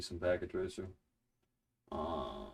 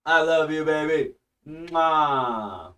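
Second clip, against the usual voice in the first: louder, higher, affectionate; fond, raised voice ending in a kiss sound; loving call-out; 6 semitones higher, 6 dB louder, much slower than usual. Real, not acted. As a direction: call it out to your wife with a kiss. Calls love to his wife.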